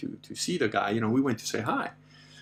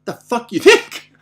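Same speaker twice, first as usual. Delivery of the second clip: loud, high mock-angry threat; retold offended outburst; 11 semitones higher, 10 dB louder than usual. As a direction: square up to the lion, offended and half laughing. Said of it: "I actually got offended. I got upset".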